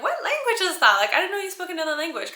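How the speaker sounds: high, puzzled viewer voice; mock confused viewer. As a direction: play a baffled follower. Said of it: "Some of you are like".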